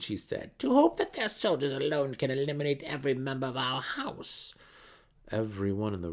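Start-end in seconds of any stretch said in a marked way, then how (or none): 0.6-4.5 a proud drow daughter sneering at the enemy; Brisa, cold priestess. Acted. Brisa scorning the attackers to her mother, high and haughty.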